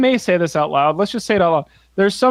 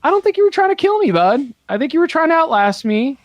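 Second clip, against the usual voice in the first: higher, fast, teasing reproach; mock-scolding bud; 9 semitones higher, faster than usual. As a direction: call him out with a smirk. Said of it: "And I was like".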